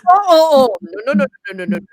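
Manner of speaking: loud, high "no no no" protest; loud refusal. Retold or imitated. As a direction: shout a firm refusal to the vet. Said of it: "and I'm like".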